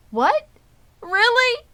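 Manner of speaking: very high, loud hopeful cry; astonished cry. Acted. Wilbur hears he won't die, crying out very high. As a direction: gasp it out in sudden hope. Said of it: "cried Wilbur".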